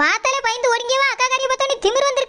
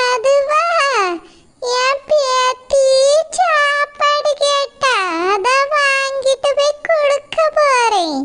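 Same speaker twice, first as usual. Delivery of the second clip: put-on high, slow old woman's voice, prying and nosy; old mother-in-law; 4 semitones higher, 3 dB louder, much slower than usual. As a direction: drag out each word like a curious granny. Old woman explains errand.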